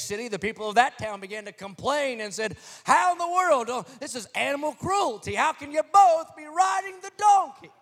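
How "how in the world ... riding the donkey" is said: high, loud shocked outcry; outraged townspeople. Acted. Townspeople protesting both riding the donkey, shocked high outcry. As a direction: protest the cruelty in disbelief. Townspeople complain about cruelty.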